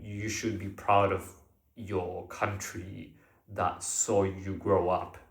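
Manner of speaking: ordinary talk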